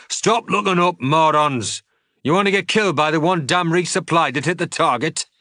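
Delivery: high, loud furious scolding; angry shouting. Acted. Revere berates troopers staring at the falling crate, furious shout. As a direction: rip into them for gawking. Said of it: "shouted Revere".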